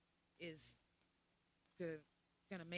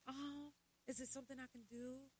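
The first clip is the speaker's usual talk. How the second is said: imitating concerned friends; 6 semitones higher than usual. Everybody asking what's wrong, mimicked high concern. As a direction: fuss over someone in a high, coddling voice. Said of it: "now everybody's like".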